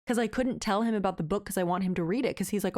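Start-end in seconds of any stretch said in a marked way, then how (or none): none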